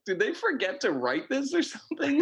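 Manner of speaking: high-pitched incredulous question through laughter; amused disbelief. Retold or imitated. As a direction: ask it laughing, voice jumping right up. Laughing disbelief at a bizarre line.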